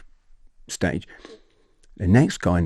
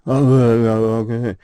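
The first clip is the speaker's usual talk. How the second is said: imitated drunken groan; 3 semitones higher than usual. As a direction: groan along like a drunk being led off. Imitating the drunk man led away.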